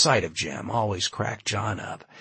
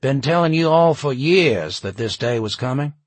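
louder, higher, to a crowd; announcing; 5 semitones higher, 7 dB louder than usual. A narrator's reading. Jim tells the gathering he warned them for years, announcing loudly. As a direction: announce it so the whole group hears. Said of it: "Jim announced".

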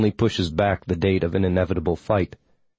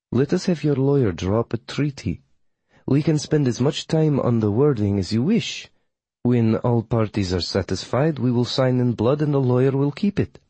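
higher, businesslike coven witch; formal witch, proposing; 4 semitones higher than usual. Acted. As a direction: offer terms formally in the witch's voice. Voices witch Melina proposing a treaty.

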